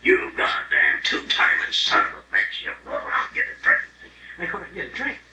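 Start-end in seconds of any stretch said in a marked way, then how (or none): 0.0-3.8 jealous husband. Retold or imitated. Husband acts out jealous outburst.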